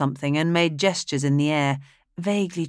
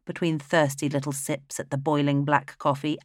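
usual speaking voice

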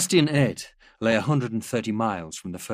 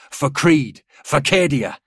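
raised, cheering battle cries; soldiers' shouts; 5 semitones higher, 7 dB louder, slower than usual. A narrator's reading. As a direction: call it out from the crowd. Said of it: "shouts of".

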